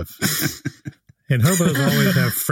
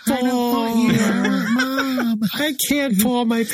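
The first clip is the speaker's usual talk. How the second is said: put-on whiny child; 8 semitones higher than usual.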